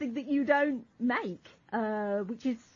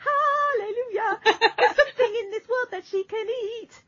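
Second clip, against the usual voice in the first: mock hallelujah; 10 semitones higher, 4 dB louder than usual. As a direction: celebrate like a preacher. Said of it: "I was gonna go".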